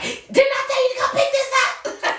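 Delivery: gangster mom. Retold or imitated. Mom snapping at her son who ignored chores, mock gangster. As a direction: snap at the kid like a gangster mom. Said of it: "you go from nice to gangster".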